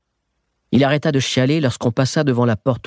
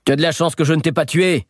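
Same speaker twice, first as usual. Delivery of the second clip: fast, higher yell; shouted warning; 8 semitones higher, faster than usual. Acted. Boy yelling at the beaten thief over the fence, fast and high. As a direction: bark it across the fence. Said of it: "criai-je".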